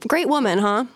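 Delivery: normal talking voice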